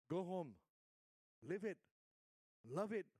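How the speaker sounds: normal talking voice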